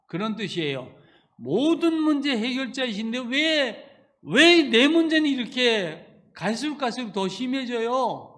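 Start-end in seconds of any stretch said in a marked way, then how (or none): 1.4-8.3 louder, higher why-me plea; complaining prayer. Retold or imitated. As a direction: protest to God in anguish. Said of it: "이렇게 하면서".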